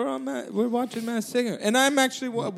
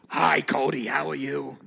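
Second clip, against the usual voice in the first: much lower, gruff put-on man's voice; Rupert voice; 8 semitones lower than usual. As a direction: drop low and play Rupert on the phone. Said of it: "Oh, Rupert".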